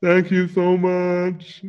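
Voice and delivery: high, slow, loud alien voice; Galaxy Quest alien. Pretend. Host thanks the guest in a high, slow Galaxy Quest alien voice. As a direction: stretch it out like an odd alien. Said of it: "doing the alien from Galaxy Quest".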